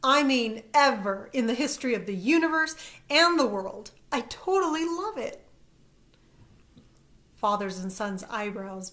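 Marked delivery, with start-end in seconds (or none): 0.0-5.5 high, loud excited rush; gushing. A narrator's reading. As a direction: gush with delight. Excited gushing over a name.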